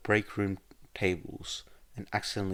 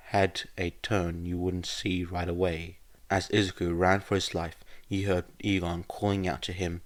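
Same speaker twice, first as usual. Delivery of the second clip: ordinary delivery, nothing marked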